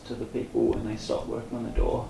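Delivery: normal talking voice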